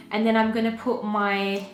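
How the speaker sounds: ordinary talk, nothing marked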